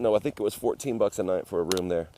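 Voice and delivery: usual speaking voice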